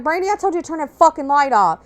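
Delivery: mother yelling from bed at the light; mom's groggy yell. Retold or imitated. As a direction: snap at the kid from bed. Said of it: "And she's like".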